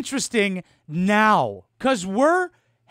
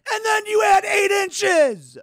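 exasperated shout; 11 semitones higher, 6 dB louder than usual. Real, not acted. Exasperated explaining of math.

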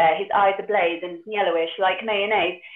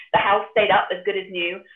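ordinary talk

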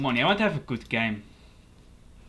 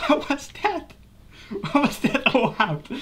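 very high, laugh-squeezed disbelief; real cracking up while asking; laughing incredulously; 12 semitones higher than usual. Real, not acted. Player baffled by opponent's move, laughing incredulously.

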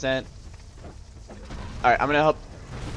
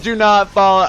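mock warning; 6 semitones higher, 10 dB louder than usual. Laughing re-enactment of own warning.

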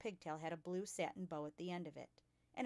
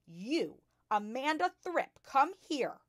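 loud, high commanding shout; 7 semitones higher, 11 dB louder, slower than usual. A narrator's reading. Headmistress orders a pupil over, shouting. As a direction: bellow it as an order. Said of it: "she was shouting".

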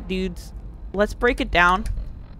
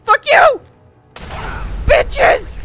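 angry shout; 12 semitones higher, much slower than usual. Real, not acted. Yelling at enemies.

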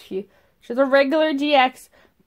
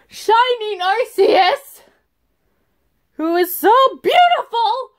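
adoring; 9 semitones higher than usual. Real, not acted. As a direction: swoon over the card. Admiring favourite card.

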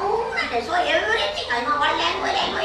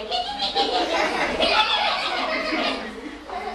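high laugh. Real, not acted. Laughing at monkey chasing butterflies.

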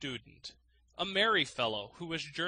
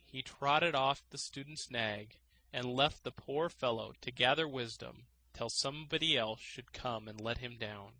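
ordinary delivery

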